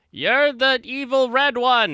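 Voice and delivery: high, loud squeaky character voice; high-pitched anime girl impression; mock anime girl voice. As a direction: squeal it like a cartoon villain-spotter. Anime character quoted in recap.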